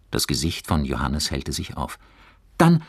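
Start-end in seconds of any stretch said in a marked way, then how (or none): 2.6-2.8 high, loud, excited suggestion; calling out. A narrator's reading. Johannes proposes a zoo trip, calling out excitedly. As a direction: burst out with a bright idea. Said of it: "rief er".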